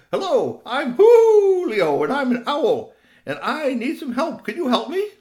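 high, loud little owl voice; an eager owlet hooting out his words; baby owl. Acted. Lost owlet asks a stranger for help, high and loud.

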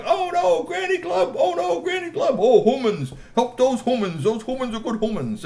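very high frantic cries; crowd panic. Acted. Trolls in the Great Hall panicking, frantic high cries. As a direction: shriek for help like a panicking crowd.

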